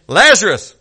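a single loud call of the name; Christ's loud summons. Acted. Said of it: "hears the voice of Christ saying".

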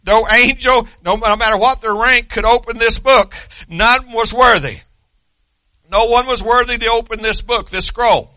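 ordinary talk, nothing marked

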